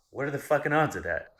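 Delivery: loud, high-pitched disbelief; incredulous outburst. Real, not acted. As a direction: exclaim at an impossible coincidence. Reacting to the coincidence, exclaims.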